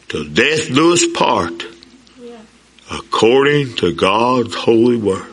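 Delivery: groom vowing to his bride, slow and solemn; solemn vows. Retold or imitated. Repeating the wedding vows.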